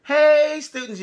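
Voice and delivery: teacher greeting. Retold or imitated. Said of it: "it's me saying".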